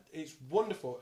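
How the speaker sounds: normal talking voice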